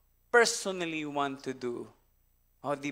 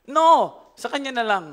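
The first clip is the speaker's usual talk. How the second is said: mother's plea; 5 semitones higher, 9 dB louder than usual. Retold or imitated. Real mother pleads, laughing.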